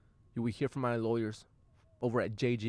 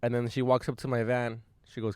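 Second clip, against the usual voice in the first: ordinary delivery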